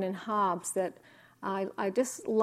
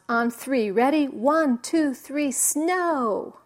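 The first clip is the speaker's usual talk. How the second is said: loud, higher mock shout counting down; shouting Calvin; 4 semitones higher, 5 dB louder, slower than usual. Calvin shouting at the sky for snow, loud. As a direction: call out to the sky like an impatient kid. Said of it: "he shouts".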